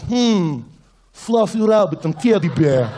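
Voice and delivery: put-on sweet words in a menacing Russian accent. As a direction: make cuddly words sound threatening in a Russian accent. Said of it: "it sounds dangerous".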